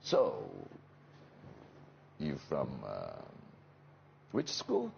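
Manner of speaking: halting question with a bit of hoarseness; slightly hoarse voice. Shown as a demonstration. Actor demonstrating the character voice for the interviewer, slightly hoarse. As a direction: ease into a hoarse voice, pausing between phrases. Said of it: "slightly getting back the kind of a hoarse voice".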